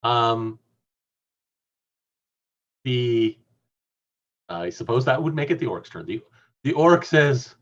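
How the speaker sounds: ordinary delivery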